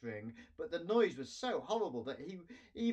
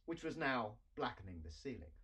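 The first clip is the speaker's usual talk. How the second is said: plain reading voice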